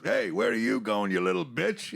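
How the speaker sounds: loud, high imitation of a heckler; mock taunt. Fighter calling after the man walking away, loud mock taunt.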